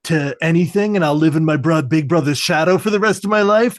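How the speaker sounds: hammed-up defensive tirade; Grifter. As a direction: pile up aggrieved questions, pitch climbing. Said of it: "really projecting and putting words in his mouth".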